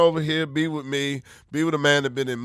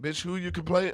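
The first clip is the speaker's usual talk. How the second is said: ordinary talk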